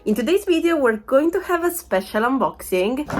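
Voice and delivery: ordinary talk, nothing marked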